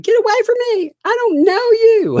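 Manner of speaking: high, loud mock child yell; imitating daughter yelling. Daughter at Walmart yelling at mom in mock shrill voice. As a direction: shriek it like a kid pretending not to know her mom. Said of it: "she yelled out".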